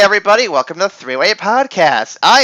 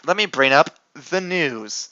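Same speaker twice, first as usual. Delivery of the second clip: ordinary talk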